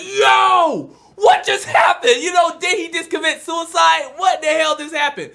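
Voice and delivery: high, loud yell of disbelief; shocked outburst. Retold or imitated. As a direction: cry out in shock, pitch way up. Acting out shock at exploding head.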